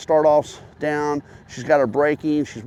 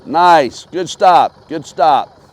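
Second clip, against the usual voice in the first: calling out praise; 2 semitones higher, 7 dB louder, much slower than usual. Real, not acted. Coach praising rider from afar.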